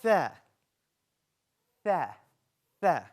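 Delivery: tha said with the tongue tip between the teeth, voiced th; letter tha. Shown as a demonstration.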